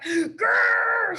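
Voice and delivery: roaring Grrr from the brother bear; bear growl. Acted. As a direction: growl like a furious bear cub. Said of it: "said the brother bear".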